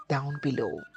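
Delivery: ordinary delivery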